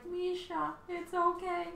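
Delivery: high cooing voice to a cat; soothing the cat. Retold or imitated. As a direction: coo to calm a pet. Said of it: "I couldn't even tell her, like".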